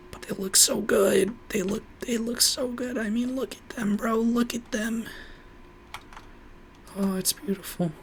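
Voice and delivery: high, breathy whispered gushing. Real, not acted. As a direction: swoon over it in a high whisper. Gushing over the planks.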